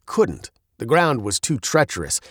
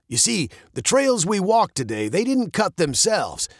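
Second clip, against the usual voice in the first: captain starting a tale for the girls, lightly voiced; teasing captain storyteller; 6 semitones higher than usual. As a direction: draw them in like a storyteller.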